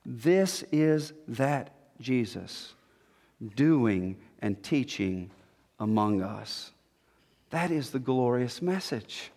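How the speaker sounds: low, soft, solemn and measured; hushed preaching. Real, not acted.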